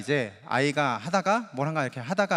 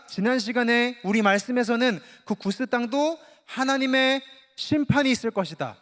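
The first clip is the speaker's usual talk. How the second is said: usual speaking voice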